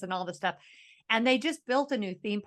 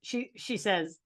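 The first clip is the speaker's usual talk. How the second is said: ordinary talk, nothing marked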